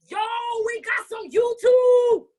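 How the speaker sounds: high, loud, stretched whoop; excited shout. Real, not acted. Host discovering they have video, thrilled shout. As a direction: yell the news, drawing out the end.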